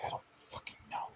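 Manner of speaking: fast whispered confusion. Acted. Mark whispering that he does not know. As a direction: whisper it quickly, baffled.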